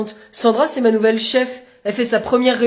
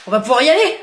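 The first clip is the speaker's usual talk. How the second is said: ordinary delivery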